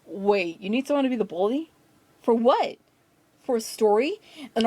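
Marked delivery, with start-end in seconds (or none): none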